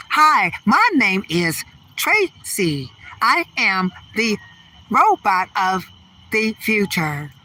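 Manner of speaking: slow, evenly spaced robot introduction. Pretend. Comic showing the audience how to talk like a robot, slow robot voice. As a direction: speak like a machine, each word slow and measured. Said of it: "You better start talking like that".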